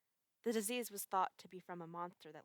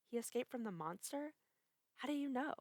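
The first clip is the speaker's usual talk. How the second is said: higher, alarmed disbelief; surprised; 6 semitones higher, 3 dB louder than usual. A narrator's reading. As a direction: gasp it out as a question. Woman startled by the news.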